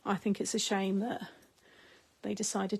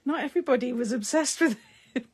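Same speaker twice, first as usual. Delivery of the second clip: louder, laughing through it; 4 semitones higher, 6 dB louder, faster than usual. Real, not acted. Retelling her reply to her nan, laughing. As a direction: giggle out the retort to nan.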